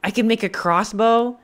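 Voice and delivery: high, loud delighted question; thrilled at the find; excited. Real, not acted. Delighted discovery.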